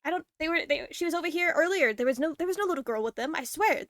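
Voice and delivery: flustered guard tripping over his words; bumbling excuse. Acted. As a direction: fumble your way through a protest. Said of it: "he's kinda, like, bumbling around".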